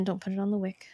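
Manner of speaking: quick whispered warning; whispering. Real, not acted. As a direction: hush it like a side warning. Quiet warning aside.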